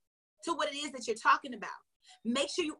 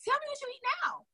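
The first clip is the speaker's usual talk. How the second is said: high, quick mock-innocent question; mock sweet question; 12 semitones higher, faster than usual. Coach mocks a fussy customer, high and sweet. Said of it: "And I'm like".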